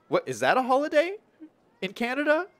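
a streamer thrown by a silly holiday, asking in surprise; surprised disbelief. Real, not acted. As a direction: pitch jumps way up in amused disbelief. Strange chat holiday.

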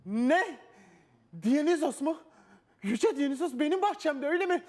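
high, loud amazed exclamation; astonished. Acted. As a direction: gasp it out in amazement. King learns guest is a god.

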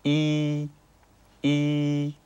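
"い" with lips pulled to both sides, high and level; spread-lip Mandarin i. Shown as a demonstration. Teacher modelling an easy vowel, spread-lip high "い". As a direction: stretch the lips and sustain a high "い". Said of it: "両側に引くような感じで".

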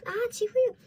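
loud high delighted recognition; excited shout. Real, not acted. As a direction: call out the name in joy. Recognizes character card.

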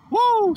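high, loud joyful woo; a real burst of church excitement; excited whoop. Real, not acted. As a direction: let out a whoop of praise. Preacher fired up mid-sermon.

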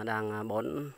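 ordinary talk, nothing marked

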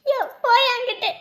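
loud, angry brush-off; angry dismissal. Acted. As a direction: tell him off loudly and push past. Mother brushes man aside.

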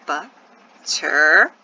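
very slow Butterfly, a long pause after each syllable; three separate beats, BUT, TER, FLY. Shown as a demonstration. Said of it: "Are you hearing the syllables".

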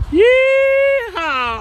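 very high shouted yeehaw; big yeehaw shout. Acted. Cowboy host shouting yeehaw before the state roll call. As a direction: throw the yeehaw way up.

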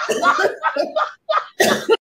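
high, real burst of laughter; shrieking laugh. Real, not acted. Guest cracking up at her own audition story, shrieking laugh.

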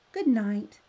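loud, lightly croaked frog voice; storybook frog croak. Acted. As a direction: croak out a bedtime farewell. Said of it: "croaked the frog".

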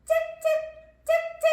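very high squeaky cheep; mouse squeak. Pretend. (아주 높게 찍찍)

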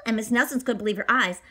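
ordinary delivery

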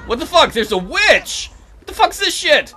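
loud, high-pitched startled cry; shocked outburst. Real, not acted. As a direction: exclaim in disbelief at a sudden intruder. Witch suddenly appears in game.

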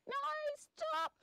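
very high squealed plea; high-pitched panicked cry; frightened wombat. Acted. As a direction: squeal to be spared as a little wombat. Wombat cries out in fear.

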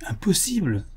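high-pitched burst of disbelief; disbelieving exclamation. A narrator's reading. Buffalo Bill refusing to believe the abduction, disbelieving exclamation. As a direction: exclaim in startled denial. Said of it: "s'écria Buffalo Bill".

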